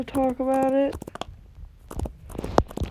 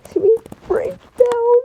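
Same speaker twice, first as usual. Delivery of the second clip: crying; 8 semitones higher, 4 dB louder than usual. Real, not acted. Owner telling viewers about her sick pet, crying. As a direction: voice breaking into tears, higher.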